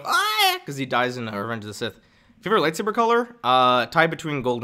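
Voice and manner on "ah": short high-pitched mock scream; sudden falsetto dying shriek; death yell. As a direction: cry out as if struck down. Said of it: "other than him going".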